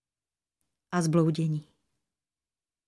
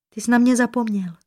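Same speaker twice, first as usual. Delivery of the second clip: higher-pitched, timid reproach; shy reproach; 5 semitones higher, 4 dB louder than usual. A narrator's reading. Princess to her sweetheart astronomer who forgot her, shy reproach. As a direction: reproach him shyly, pitched up. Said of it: "plachá výčitka plula jí po tváři".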